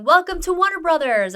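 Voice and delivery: high, loud announcing flourish; grand welcome. Retold or imitated. Retelling the man turning round to welcome her, grandly. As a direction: announce it like a big reveal. Retold surprise welcome to studio.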